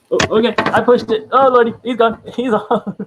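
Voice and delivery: high, loud startled outburst; real panicked squeal. Real, not acted. Host who just pushed the creature, panicked squeal. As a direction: squeal in alarm as it takes off.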